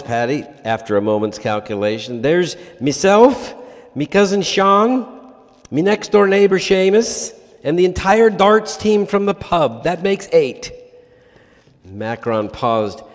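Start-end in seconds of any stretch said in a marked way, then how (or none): 2.2-10.7 put-on a light, higher, louder stab at an Irish accent; hammed-up Irish pub caller declaring war; attempted Irish accent. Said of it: "needs an Irish accent".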